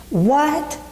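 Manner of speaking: high, disbelieving what; jolted by the unfair verdict; stunned what. Retold or imitated. Reacting to the president's verdict.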